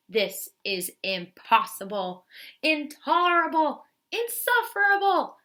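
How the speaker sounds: building anger. Acted. Bear shaking with anger at the mouse, building fury. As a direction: boil over, word by word. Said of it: "shaking with anger and disbelief".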